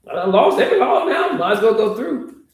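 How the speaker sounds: high, loud and fed up; loud despair. Acted. Disciple giving up on Jesus, loud and exasperated. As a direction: throw your hands up, out loud.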